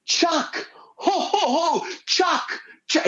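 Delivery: high-pitched mock yell, no real volume; Santa yelling. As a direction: imitate Santa hollering from the float. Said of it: "and he yells, and he goes".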